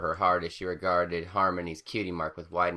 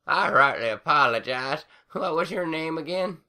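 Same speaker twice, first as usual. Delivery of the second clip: put-on old granny voice; 10 semitones higher, 6 dB louder than usual. A narrator's reading.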